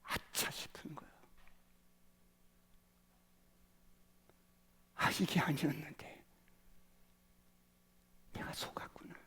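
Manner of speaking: hushed whispered regret; regretful whisper. Acted. Moses realizing his mistake, whispering. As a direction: breathe out the realization softly.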